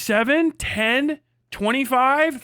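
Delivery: usual speaking voice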